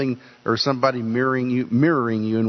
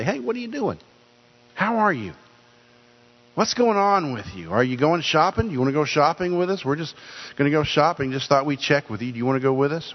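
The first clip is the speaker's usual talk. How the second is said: much higher, bright friendly check-in; cheerful phone call; 3 semitones higher than usual. Shown as a demonstration. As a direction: sound delighted to be calling your mom. Demoing a cheerful call to mom.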